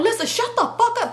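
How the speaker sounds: quick, high mock yell; re-enacted yelling. Speaker snapping at her friend, acted quick yell. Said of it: "I started yelling".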